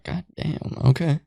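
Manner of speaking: breathy whisper of disbelief; whispered aside. Retold or imitated. Watching the credits, whispering to himself in disbelief. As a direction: whisper it under your breath.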